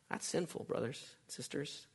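breathy near-whisper, pulled way down; hushed warning. Real, not acted. Preacher hushing the congregation with a near-whisper warning. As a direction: lean in and warn them under your breath.

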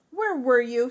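loud angry shout; furious mom shouting. A narrator's reading. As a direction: shout it at your runaway daughter. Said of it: "she shouted".

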